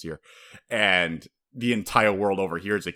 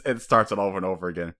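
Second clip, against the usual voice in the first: ordinary talk, nothing marked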